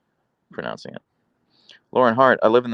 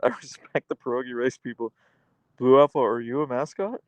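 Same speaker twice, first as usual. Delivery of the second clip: laughing; 3 semitones higher than usual. Real, not acted. Laughing while teasing possible mascot.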